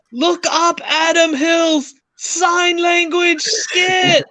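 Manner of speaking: put-on very high, drawn-out comic yell in an Australian accent. Imagining the commenter yelling his comment through a sandstorm, mock Australian yell. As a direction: holler it slowly across a sandstorm. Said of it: "thinking of him yelling stuff like".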